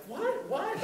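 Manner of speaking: very high-pitched, disbelieving questions; mock incredulous. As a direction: squeak it out as comic disbelief. Acts out own disbelief at offer.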